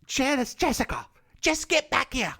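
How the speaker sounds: put-on high, bossy old lady calling out from the back seat. Old lady in the back seat orders JB back. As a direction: call her back like a stern old aunt.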